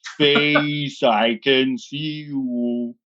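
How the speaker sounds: slow, low, eerie vampire drawl. Acted. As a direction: intone like a vampire from space. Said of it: "That's actually my vampire voice".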